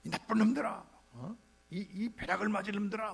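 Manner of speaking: preacher voicing John cursing the crowd; angry cursing. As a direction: curse them out like an angry prophet. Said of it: "막 욕하는 거예요".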